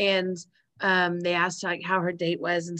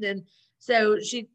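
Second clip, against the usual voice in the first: ordinary talk, nothing marked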